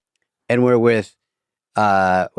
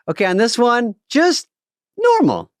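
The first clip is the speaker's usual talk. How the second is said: high mock instruction; 8 semitones higher than usual. Mimicking director's instruction.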